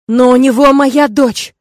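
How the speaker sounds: loud, slow desperate cry; panicked shout. Acted. Father panicking for daughter.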